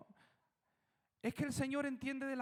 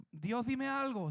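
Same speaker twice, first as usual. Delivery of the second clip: pleading; 5 semitones higher, 4 dB louder, slower than usual. Acted. Pleading with silent God.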